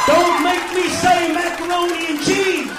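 loud, high yelled threat; ranting at the crowd, a loud high shout. Acted. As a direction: yell it at the crowd like a warning.

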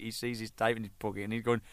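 normal talking voice

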